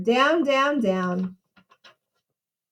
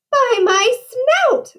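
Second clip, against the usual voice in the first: high, drawn-out storybook cry of alarm; 10 semitones higher, 4 dB louder, slower than usual. A narrator's reading. Horace the pig spots the wolf at his window, alarmed cry. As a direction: cry out slowly in a high piggy panic. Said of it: "cried Horace".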